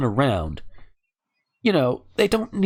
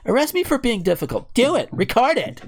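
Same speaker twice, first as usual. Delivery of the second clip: higher-pitched, lightly mocking defiant quote; 6 semitones higher than usual. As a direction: lightly act out a protester daring the officer. Acting out arrested woman's defiance.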